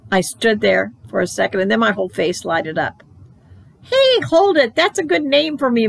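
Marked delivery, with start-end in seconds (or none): 3.8-5.9 high, bright sudden idea; light up with a new idea; a child's burst of excitement; excited. Acted.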